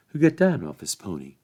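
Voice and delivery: ordinary delivery, nothing marked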